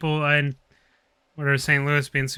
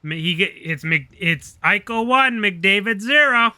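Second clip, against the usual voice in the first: higher, louder gloating announcement; mock NHL boast; 6 semitones higher, 3 dB louder, slower than usual. Mocking the NHL, gloating boast. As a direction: crow it like a league promo. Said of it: "be like".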